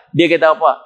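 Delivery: usual speaking voice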